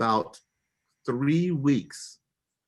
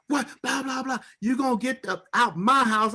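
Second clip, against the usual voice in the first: high-pitched mock rant, louder at the end; imitated angry yelling; 13 semitones higher, 3 dB louder than usual. Storyteller imitating wife ordering him out, mock yelling. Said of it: "yelling, cussing, getting in my face".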